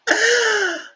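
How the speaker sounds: loud high burst of laughter; real loud laugh. Real, not acted. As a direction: crack up out loud. Laughing at Tish segment.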